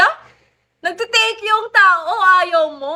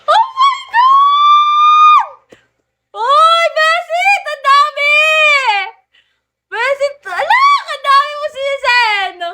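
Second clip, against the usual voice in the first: screaming in shock; 9 semitones higher, 5 dB louder than usual. Real, not acted. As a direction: scream your amazement at a huge gift. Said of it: "Pinasigaw mo ako ng ganon-ganon". (napasigaw sa sobrang gulat at tuwa)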